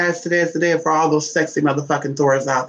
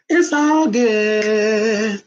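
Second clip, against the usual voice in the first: high, long drawn-out croon; sing-song reassurance; 6 semitones higher, much slower than usual. Real, not acted. Host soothing the dog, high drawn-out sing-song. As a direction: stretch it out, high and singsong.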